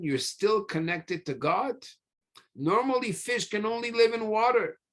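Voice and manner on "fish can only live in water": usual speaking voice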